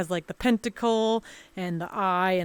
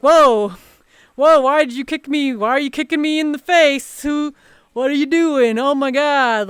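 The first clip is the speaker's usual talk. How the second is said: louder, higher startled whoa; startled protest; 4 semitones higher, 5 dB louder than usual. Retold or imitated. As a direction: yelp it out as if kicked out of nowhere. Stranger kicks her in VR.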